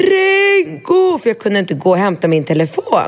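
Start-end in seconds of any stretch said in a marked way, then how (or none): 0.0-1.2 mother yelling for her son; calling out. Retold or imitated. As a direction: call the boy from another room.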